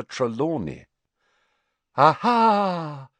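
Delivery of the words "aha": high, loud dramatic cry; theatrical high-pitched exclamation; triumphant cry. A narrator's reading. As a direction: cry it out like catching a culprit. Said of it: "she cried".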